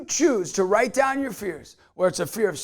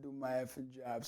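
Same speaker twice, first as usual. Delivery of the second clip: much lower, much softer, near-hushed; quiet retelling; 8 semitones lower, 18 dB quieter than usual. Retold or imitated. Vlogger reflecting on the homeless man's words, hushed and soft. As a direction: murmur it to yourself as a resolution. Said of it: "That, that homeless guy said".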